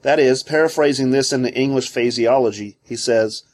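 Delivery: normal talking voice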